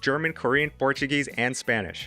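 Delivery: upbeat, polished ad read over music; bright, energetic read of a sponsor spot. Acted. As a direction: sell the product cheerfully.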